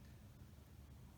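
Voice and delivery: voiceless sigh of air; just breathe out a sigh; breathy sigh. Shown as a demonstration. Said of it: "And then another".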